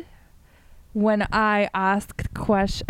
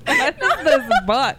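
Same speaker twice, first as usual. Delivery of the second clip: amused disbelief through laughter; laughing; 3 semitones higher, 4 dB louder than usual. Real, not acted. Teasing her friend about the novel, laughing. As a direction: ask it while cracking up.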